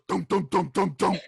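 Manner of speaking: vocal banging on a door; imitated door knocking. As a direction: pound out each beat with the voice.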